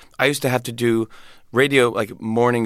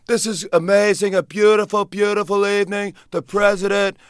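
Trump impression; 9 semitones higher than usual. Comic mocking the president as Trump. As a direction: ham up Trump praising the evening. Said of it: "I did a Donald Trump impression".

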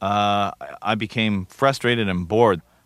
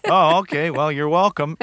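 higher-pitched, bemused acted thanks; bemused reply; 6 semitones higher than usual. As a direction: play taken aback by the thanks. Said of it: "and it was like".

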